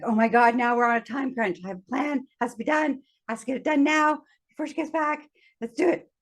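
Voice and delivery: lightly acted time-crunch fluster; mild retold panic. Acting out her panic over time.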